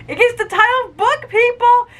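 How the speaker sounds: high and loud, gushing; excited praise. Real, not acted. Reviewer telling viewers the novella earns the title book, high and loud. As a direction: proclaim it with delight.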